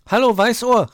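high, full-voiced shout of greeting; Borstel calling out loudly. A narrator's reading. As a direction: hail a friend at the top of your lungs. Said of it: "rief Borstel lauthals".

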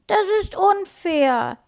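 high, loud, drawn-out whine; childish protest, stretched out and high; tearful little girl. A narrator's reading. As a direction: wail it slowly as a hurt child. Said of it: "Lena standen Tränen in den Augen".

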